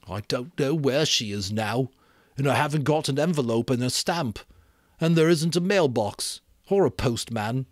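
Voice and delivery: put-on deadpan boy listing what's missing; solemn Piggy. A narrator's reading. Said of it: "Piggy considered this solemnly".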